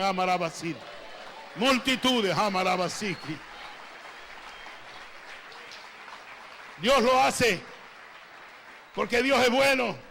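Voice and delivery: loud, emphatic pulpit proclamation; fervent prophetic preaching. Real, not acted. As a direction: proclaim it forcefully to the whole church. Prophesies and preaches at full force.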